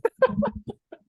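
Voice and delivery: squealing burst of laughter; high laugh. Real, not acted. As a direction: crack up high. Laughing at the head joke.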